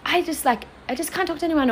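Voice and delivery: mock her own overwhelmed brush-off; overwhelmed whine. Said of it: "So I'd be like".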